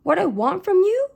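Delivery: high, loud incredulous echo. A narrator's reading. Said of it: "He repeated himself".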